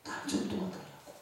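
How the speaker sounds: unvoiced, hushed plea; whispered prayer. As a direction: breathe the prayer softly. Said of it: "마음이 기도했어요".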